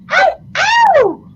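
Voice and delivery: very high, loud "ow!" whoop; piercing playful yelp; catcall howl. Real, not acted. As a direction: howl in admiration. Catcalling at a picture.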